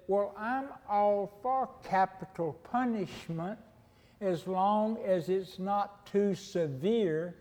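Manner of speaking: slow, lightly put-on New York accent; a hint of Edith Bunker's New York drawl, slowed; imitating Edith Bunker's New York accent. Preacher quoting Edith Bunker to the congregation, light New York accent. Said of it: "how she talked with that New York accent".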